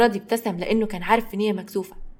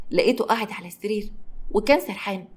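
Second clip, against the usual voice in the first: ordinary delivery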